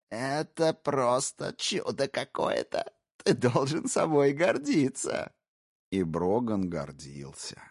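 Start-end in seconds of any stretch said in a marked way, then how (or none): 0.1-5.3 slow, higher, sarcastic admiration; mock praise. Intruder mocking the husband, slow sarcastic praise. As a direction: sneer as if impressed.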